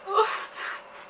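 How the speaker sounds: laugh-cry. Real, not acted. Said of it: "I actually feel like crying".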